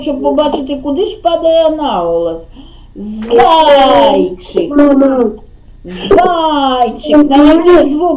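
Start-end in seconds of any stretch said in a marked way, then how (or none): 3.0-7.3 Зайчик with the first syllable, зай, stretched long; drawn out. Shown as a demonstration. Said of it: "розтягнути так слово".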